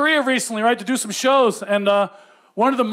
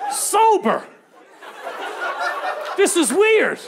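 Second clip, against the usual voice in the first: incredulous; 6 semitones higher, much slower than usual. Real, not acted. Disbelief at weekday karaoke.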